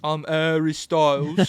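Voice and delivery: loud, hammed-up British introduction; mock British accent. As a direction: imitate a British pop star introducing himself. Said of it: "I liked it when he said that".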